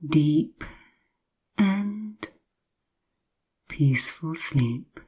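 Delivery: usual speaking voice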